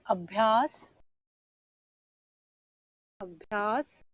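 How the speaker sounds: अभ्यास drawn out slowly, each sound clear; slow careful pronunciation. Shown as a demonstration. Teacher dictates अभ्यास slowly to the class, careful pronunciation. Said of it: "अभ्यास होता है".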